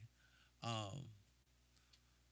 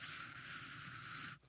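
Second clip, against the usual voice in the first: blown-back sigh; 11 dB quieter than usual. Retold or imitated. Hair blown back by speaker.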